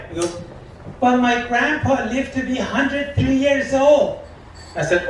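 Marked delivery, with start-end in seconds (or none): 1.0-4.2 lightly voiced small boy, slow and higher; a hint of a cheeky ten-year-old; kid voice. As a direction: kid sassing a stranger, slightly higher and slower. Kid answering back on bus.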